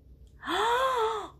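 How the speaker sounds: loud sharp inhale before blowing; deep breath in. Retold or imitated. Teacher showing her kids' candle breath, sharp inhale. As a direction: suck in air for the candles. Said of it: "Blow out five birthday candles".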